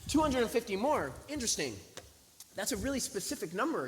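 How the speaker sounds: high-pitched, exaggerated disbelief; incredulous scepticism. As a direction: play up your astonishment at the sum. Sceptical, astonished reaction to request.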